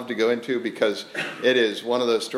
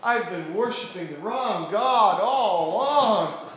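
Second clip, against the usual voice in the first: high, slow mock confession; drawn-out wide-eyed conversion; imagined Jezebel converting; 7 semitones higher, slower than usual. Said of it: "Jezebel is going to go".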